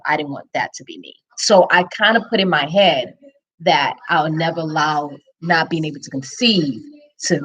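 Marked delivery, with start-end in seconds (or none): 0.1-1.1 inner vow. Retold or imitated. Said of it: "I said to myself".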